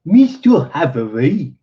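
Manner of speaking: attempted German accent on a greeting. Colonel greeting the engineer, in an attempted German accent. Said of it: "with something of a German accent".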